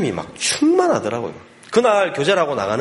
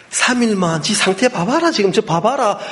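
high, pressing plea; retold insistent urging; 9 semitones higher, 4 dB louder than usual. Doctor coaxing father to keep son three days, insistent urging. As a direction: push hard to persuade, acted out.

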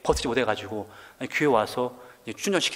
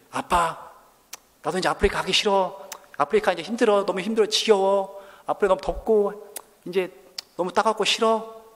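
higher, pressing complaint to dad; mock whining child; 5 semitones higher than usual. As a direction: whine like a fed-up kid, hammed up. Acts children whining about Africa. (아빠에게 투정하는 아이 흉내)

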